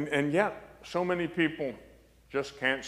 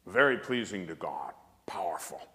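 hushed whisper; 7 semitones lower, 7 dB quieter than usual. Real, not acted. Priest whispering to the congregation about trust. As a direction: drop to a whisper for emphasis.

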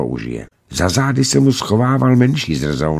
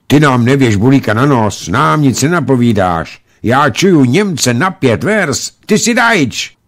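put-on high, loud child's challenge; accusing boy; 6 semitones higher, 5 dB louder than usual. A narrator's reading. Boys accuse a stranger of being German, accusing child voice. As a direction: push it out like a suspicious kid.

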